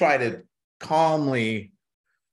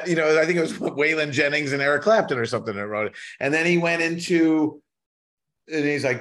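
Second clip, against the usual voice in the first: ordinary delivery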